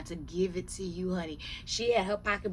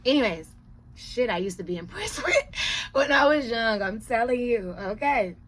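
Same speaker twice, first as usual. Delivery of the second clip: loud laughing; 4 semitones higher, 8 dB louder than usual. Real, not acted.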